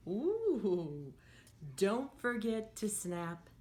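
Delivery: sing-song storybook read for little ones; lively picture-book voice; reading to children. Acted. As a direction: read it like a teacher at story time. Teacher reads picture book aloud.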